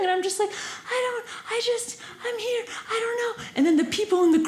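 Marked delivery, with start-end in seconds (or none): 0.5-3.3 small, high, soft flustered voice; timid. Retold or imitated. Frozen girl answers a shouting driver, timid. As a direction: stammer helplessly, much softer and higher.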